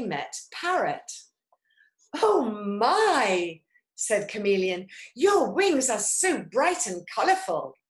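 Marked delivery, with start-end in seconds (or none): 2.1-3.6 loud, drawn-out admiring exclamation; wide-eyed storybook wonder; admiring surprise. A narrator's reading. Chameleon admires Parrot's wings. 5.1-7.8 loud, raised admiring compliment; lively storybook admiration. A narrator's reading. Chameleon admires Parrot's wings.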